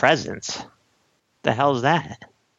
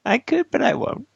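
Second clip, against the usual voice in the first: mocking echo; 9 semitones higher than usual. Echoing in mocking voice.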